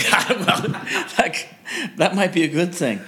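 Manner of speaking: high laughing reply. Real, not acted. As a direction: laugh through the words. Laughs at joke.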